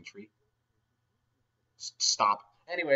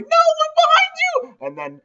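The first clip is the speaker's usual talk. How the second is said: much higher, louder, slow panicked voice; hammed-up fright; 12 dB louder, slower than usual. Storyteller warning of Slendermen, high mock panic. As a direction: sell the scare of the story's twist.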